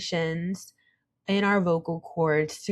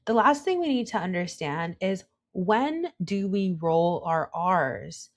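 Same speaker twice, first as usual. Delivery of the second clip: normal talking voice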